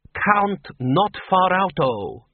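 sharp, slow correction. A narrator's reading. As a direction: snap the name out slowly. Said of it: "said a female voice sharply".

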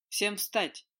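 loud barking order; barked command. A narrator's reading. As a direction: bark it at full voice. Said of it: "в полный голос рявкнул он".

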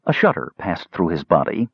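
ordinary delivery

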